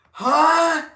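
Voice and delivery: high, loud shocked "huh"; shocked cry. Real, not acted. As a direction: yelp in disbelief. Viewer says he got suspended.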